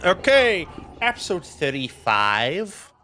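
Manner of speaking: high, lifted "okay" easing into a slow announcement; playful mock-announcer opening; put-on voice. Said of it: "starting off with that voice".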